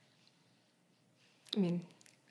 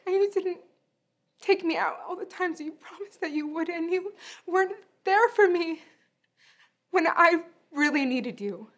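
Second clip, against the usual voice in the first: high, cracking hurt pitch; voice breaking; 10 semitones higher than usual. Acted. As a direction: let the voice crack with old hurt. Hurt accusation to absent father.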